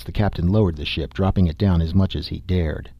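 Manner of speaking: ordinary delivery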